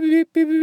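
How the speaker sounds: high, quick beep beep beep like a timer; imitating watch beeps. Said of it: "it goes beep".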